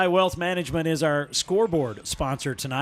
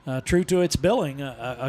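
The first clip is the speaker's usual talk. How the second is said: ordinary talk, nothing marked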